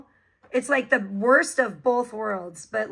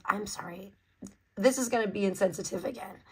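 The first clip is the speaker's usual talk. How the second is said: usual speaking voice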